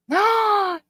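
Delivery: sharp high gasp of delight; surprised gasp. Real, not acted. Streamer gasping as a friend joins her chat. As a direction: catch your breath at the sight.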